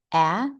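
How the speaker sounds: "ah" as the open vowel in apple; short A sound. Shown as a demonstration. Teacher demonstrating vibration in the A sound, short A sound. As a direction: say "ah" twice, short and separate, mouth spread. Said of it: "than we do with".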